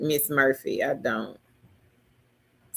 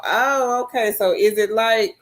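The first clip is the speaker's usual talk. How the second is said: normal talking voice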